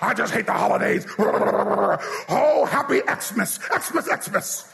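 grumbling, grouchy holiday hater; mock a sour Scrooge griping about Christmas; holiday curmudgeon. Preacher imitates someone who won't say Christmas, grumpy curmudgeon. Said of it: "I can't be a holiday curmudgeon".

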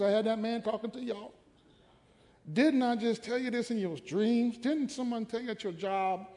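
exasperated God. Retold or imitated. God fed up with excuses, lightly exasperated. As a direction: nag like a tired parent. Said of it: "he like".

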